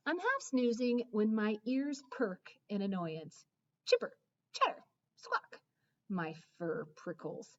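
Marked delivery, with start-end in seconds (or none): 3.9-5.6 much higher, breathy squirrel screech; mock squirrel squawk; squirrel chatter. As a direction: chitter like a nasty squirrel. Dog imitates squirrel noise.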